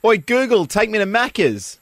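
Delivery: a yelled command easing off at the end; mock yell. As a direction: call out to Google like a mate, high-pitched. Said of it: "ever wanted to yell at your Google Maps".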